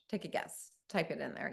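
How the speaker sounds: whispered tease. Real, not acted. Speaker teasing the audience to guess, whispering. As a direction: breathe it to the crowd as a secret.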